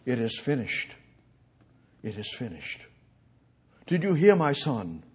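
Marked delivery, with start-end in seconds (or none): 0.1-2.8 fading to a near hush; a hushed echo from the cross; quiet last words. Acted. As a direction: let it die away softly. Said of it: "John nineteen".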